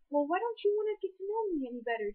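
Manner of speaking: hint of a clingy complaint, rushed; pushy neighbor. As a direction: lightly mimic a needy, pushy neighbor. Mock pushy neighbor, what not to do.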